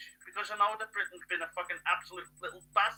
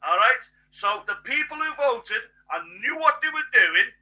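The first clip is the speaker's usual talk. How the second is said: angry rant; 5 semitones higher, 9 dB louder than usual. Real, not acted. Angry political rant at voters.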